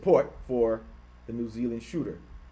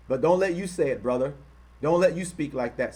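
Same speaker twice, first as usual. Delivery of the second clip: ordinary delivery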